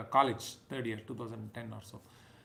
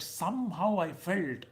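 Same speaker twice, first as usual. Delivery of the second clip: ordinary talk, nothing marked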